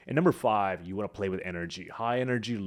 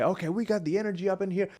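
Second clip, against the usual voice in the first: much higher, pushy cheer; forced hype; 10 semitones higher, faster than usual. Shown as a demonstration. Speaker pressing a silent audience for energy, forced hype. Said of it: "and you say".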